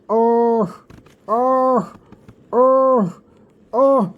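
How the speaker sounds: high strained moans of tooth pain, retold; pained moaning. Retelling lying in bed with a swollen cheek, high pained moans. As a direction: groan out each oh as if the swollen jaw throbs. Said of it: "the pain was just so unbearable".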